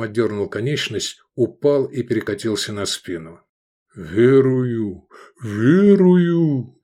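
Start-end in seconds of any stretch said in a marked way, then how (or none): 3.9-6.8 bellowing moan. A narrator's reading. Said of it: "промычал Кеклоп".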